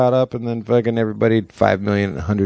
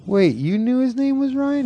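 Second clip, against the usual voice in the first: high incredulous question in a retold bit; mock disbelief. Friend retelling a sketch, high mock disbelief. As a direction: act baffled, pitched way up.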